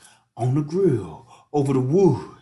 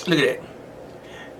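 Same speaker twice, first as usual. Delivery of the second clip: whispering; 6 dB quieter, much slower than usual. Real, not acted. Admiring the steak, repeated.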